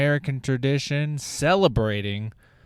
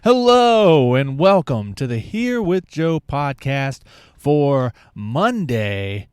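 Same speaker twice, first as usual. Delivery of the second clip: loud welcome; 5 dB louder, slower than usual. Real, not acted. Opening the podcast.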